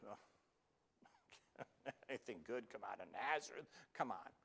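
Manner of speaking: unvoiced oh then a mocking chuckle; a hint of skeptical Nathanael; scoffing laugh. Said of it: "Nathanael says".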